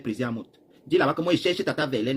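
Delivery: ordinary talk, nothing marked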